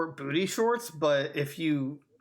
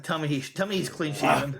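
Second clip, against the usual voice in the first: ordinary talk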